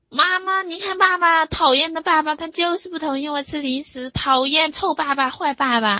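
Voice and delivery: put-on high, loud whiny kid complaining to mom; upset crying child. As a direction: act out a child wailing about mean daddy. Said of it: "这个孩子有可能哭了一会儿".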